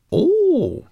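very high, loud drawn-out oh; brownie girl's realisation. Acted. Sorrel hearing Ben explain archaeology, suddenly enlightened. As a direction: let it dawn in a squeal.

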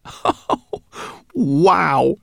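high, loud amazed oh wow; exclaimed. Acted. Boy exclaiming in wonder at the brownie. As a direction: burst out in delight. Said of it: "he exclaimed".

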